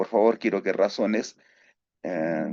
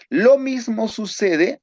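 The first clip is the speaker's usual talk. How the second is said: raised restart; 11 semitones higher, 3 dB louder than usual. Real, not acted. Teacher restarts the point louder.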